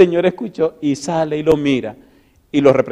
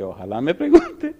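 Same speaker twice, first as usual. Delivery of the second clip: much higher, giggling through the wish; laughing wish; 10 semitones higher, 5 dB quieter than usual. Retold or imitated. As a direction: squeal the hope out, laughing. Timid boy hoping girl asks.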